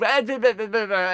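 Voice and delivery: high, loud mimicked gabble; mock piping up. Comic imitating offended woman piping up, mocking gabble. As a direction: imitate someone's protest as loud gibberish. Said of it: "And then she piped up".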